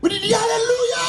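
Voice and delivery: Alléluia cried out high and loud, almost breaking; shouted alleluia. Shown as a demonstration. Preacher mimics the congregation's shouted, crying Alléluia. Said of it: "Il dit, Alléluia".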